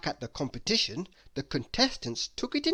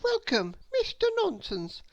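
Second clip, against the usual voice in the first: put-on little girl asking. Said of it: "said little Miss Brainy".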